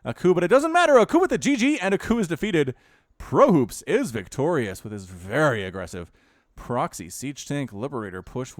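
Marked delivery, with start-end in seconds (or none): 0.0-4.8 loud, high-pitched final call; excited play-by-play. Real, not acted. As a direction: call the defeat at full volume. Calling the game's final moment.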